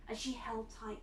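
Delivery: plain reading voice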